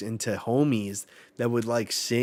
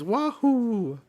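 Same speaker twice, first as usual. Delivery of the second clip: high, loud, cartoonish cheer; Mario "wahoo"; 12 semitones higher, 6 dB louder than usual. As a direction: squeak it out like a plumber mascot jumping. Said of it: "It's like fucking Mario".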